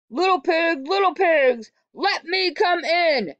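wolf at the brick house, demanding loudly. Acted. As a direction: demand entry like a hungry wolf. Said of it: "demanded the wolf".

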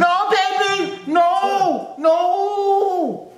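very high, long wailing cry; hammed-up dismay. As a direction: wail each no in a held high note. Overdone dismay at the clip.

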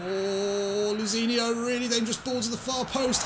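high excited football call; excited commentary. Real, not acted. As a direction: call the cross with rising alarm. Commentating a dangerous cross.

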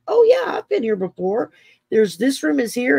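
put-on high, loud burst of childlike certainty; excited insisting. Nine-year-old insisting to doubting family, high excited voice. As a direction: eagerly insist you know this house.

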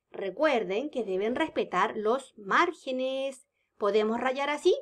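ordinary delivery

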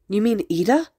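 high, rising disbelieving question; exclaiming. A narrator's reading. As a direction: ask in astonishment. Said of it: "the brown-haired girl exclaimed".

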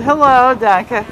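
ordinary talk, nothing marked

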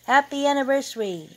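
high, loud, quick cheer; group shout. A narrator's reading. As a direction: shout it like a crowd answering a toast. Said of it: "everybody shouted".

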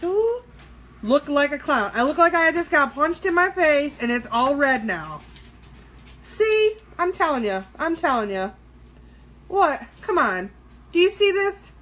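high, loud ranting at the mishap; exasperated complaint. Real, not acted. Vlogger shows viewers her red clown face, loud exasperated complaint. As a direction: protest loudly with jumps up in pitch.

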